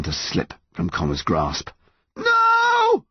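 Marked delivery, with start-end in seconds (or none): 2.2-3.0 long, high cry of refusal; calling out. A narrator's reading. Son calls as his mum slips from his grasp. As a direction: call out in desperation. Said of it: "he called".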